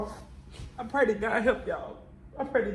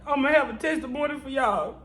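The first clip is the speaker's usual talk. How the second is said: preaching cry; 3 semitones higher, 4 dB louder than usual. Real, not acted. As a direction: declare it like a sermon. Testifying, crying out to God.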